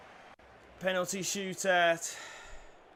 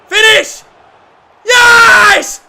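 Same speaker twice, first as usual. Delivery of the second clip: very high, very loud yelling, pace slowing at the end; ecstatic screaming; 11 semitones higher, 18 dB louder than usual. Real, not acted. Gamer screaming at Kimmy to get the ball, very high and loud. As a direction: scream like the winning goal just went in. Screaming as his player scores.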